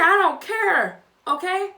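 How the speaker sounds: loud, high retort; unafraid defiance. Retold or imitated. Eric standing up to Chris, defiant. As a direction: throw it back loudly, acting unbothered. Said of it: "Eric ain't scared, boy".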